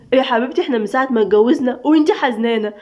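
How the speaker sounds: ordinary delivery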